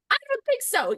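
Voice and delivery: high, sassy no; mock sass. Wife calling her husband out, high sassy. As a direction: toss it back at him with attitude. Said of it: "stood in his face and called him on the carpet".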